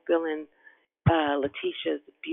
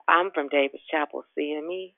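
usual speaking voice

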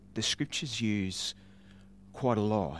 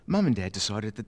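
ordinary delivery

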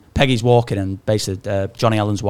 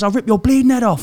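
mock threat; 12 semitones higher, 6 dB louder than usual. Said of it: "he goes".

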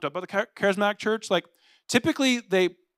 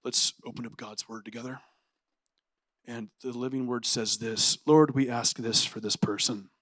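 low, soft, restrained prayer; doctrinal church prayer; 6 semitones lower, 5 dB quieter than usual. Acted. Doctrinal church member praying for someone, low and restrained. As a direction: imitate a sedate, measured pray-er. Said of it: "someone in the doctrinal church that prays for you is like".

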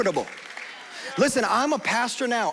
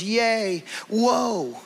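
mock spiritual whoa; 3 semitones higher than usual. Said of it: "just throwing something like that in".

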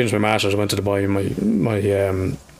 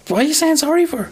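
squeaky incredulous question, far higher; high mock exasperation; 13 semitones higher than usual. Exasperated counsellor asks a crying client, high and incredulous. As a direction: play it as a disbelieving high squeak.

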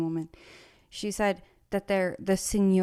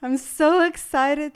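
higher, louder, slowed gushing; mimicking a thrilled worker about her boss; excited employee; 6 semitones higher, 4 dB louder than usual. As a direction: imitate a starstruck hotel worker. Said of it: "she's like".

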